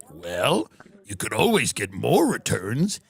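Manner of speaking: a deep-voiced tycoon politely ending a call; Wexner voice.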